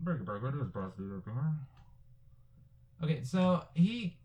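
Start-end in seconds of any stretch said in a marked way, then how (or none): 0.0-1.5 over walkie talkie. Pretend. Mock CB radio reply.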